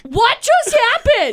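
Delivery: high, slow, stunned disbelief; retelling her own shock at the gas station. Retold or imitated. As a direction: act baffled by what you just saw. Her own stunned reaction, quoted.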